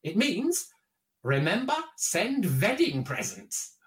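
put-on husband announcing his discovery in a heavy Eastern European accent; comic Eastern European accent, starting high; thick Eastern European accent. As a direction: play the proud solver with an Eastern European accent. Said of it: "in his thick Eastern European accent".

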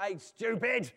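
loud, exasperated mock shout; imitated shout. God with every right to shout, exasperated. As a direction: shout it out as a rebuke. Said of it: "every right to shout".

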